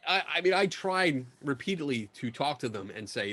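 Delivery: ordinary talk, nothing marked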